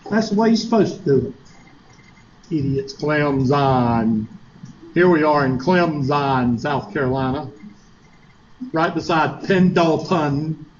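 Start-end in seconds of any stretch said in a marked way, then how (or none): 2.9-7.5 Clemson said slowly with a buzzing z, the transplant way; mocking outsider pronunciation. Teacher mimicking transplants who mispronounce Clemson, slow and mocking. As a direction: drag out Clemson like a newcomer. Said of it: "that's how you can tell when somebody's not from around here".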